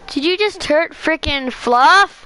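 stunned and protective of the cat; slow, loud, high disbelief; shocked outburst. Real, not acted. Cat hurt during the stream.

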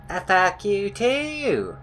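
high, slow, stretched-out comeback; silly singsong mock retort. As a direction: pitch it up and drag out the last word.

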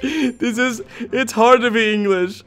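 slow, strained laughing groan; half laugh, half wail; giggling despair; laughing frustration. Real, not acted. Fails again, laughing.

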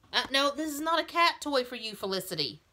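firm no to a meddling pet; scolding the cat. Real, not acted. Owner stops her cat taking the doll's outfit, scolding.

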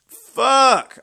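loud, high curse; real frustrated shout; furious shout. Real, not acted. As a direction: blurt it out in frustration. Bad summon result.

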